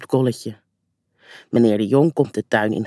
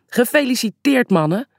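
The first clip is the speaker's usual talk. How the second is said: calling out cheerfully; 10 semitones higher than usual. A narrator's reading. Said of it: "roept papa".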